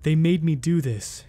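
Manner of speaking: usual speaking voice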